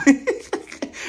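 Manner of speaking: high-pitched burst of laughter. Real, not acted. Host cracks up at own name-calling remark, laughing.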